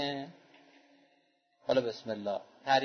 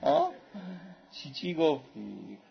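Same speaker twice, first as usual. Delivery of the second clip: put-on amazed Turkish accent; 3 semitones higher, slower than usual. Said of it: "با همون لهجهٔ ترکی در مقام ابراز شگفتی".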